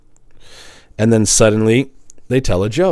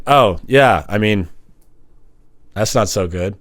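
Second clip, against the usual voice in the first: unimpressed; 5 semitones lower than usual. Retold or imitated. Listener underwhelmed by his impression. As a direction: sound underwhelmed. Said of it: "Then I do the impression".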